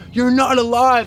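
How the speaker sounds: high, mildly alarmed protest; retold fear. Dreamer to dead dad, afraid. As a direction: push the apparition away in mock alarm. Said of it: "I was being afraid".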